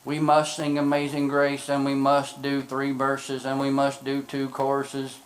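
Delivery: low, soft, measured monotone; mock-solemn, subdued church voice; staid churchgoer. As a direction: recite the rules like stiff religious duty. Mimics staid, rule-bound churchgoers.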